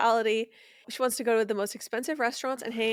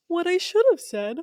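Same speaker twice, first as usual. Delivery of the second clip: higher, pompous mock of Gary's excuse; 6 semitones higher than usual. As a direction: quote him like a smug dodge. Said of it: "He goes".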